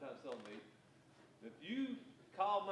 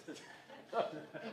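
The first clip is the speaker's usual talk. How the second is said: breathy chuckle. Real, not acted. Salesman chuckling at the straw salesman comeback, airy laugh.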